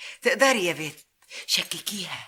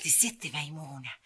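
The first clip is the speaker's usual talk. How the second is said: lowered, reverent naming of the spirit; hushed awe; 8 semitones lower, 9 dB quieter than usual. Acted. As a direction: drop to a reverent near-hush.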